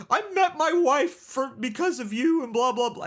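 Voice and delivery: exaggerated tearful falsetto, mocking him; mock crying. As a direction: imitate an upset husband bawling. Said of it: "it was, like, crying".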